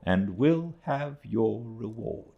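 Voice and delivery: put-on high, slow elderly aunt; aunt's voice. A narrator's reading. Aunt praising Mister Peggotty, high slow voice.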